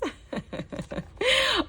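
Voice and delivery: high giggle. Real, not acted. Laughing over the Paris book.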